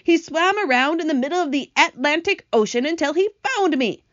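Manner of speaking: high, loud, excited account; gasped amazement. Acted. Centipede praises his rescuer James, excited high exclamation. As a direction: tell of the rescue pitched right up. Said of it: "gasped the centipede".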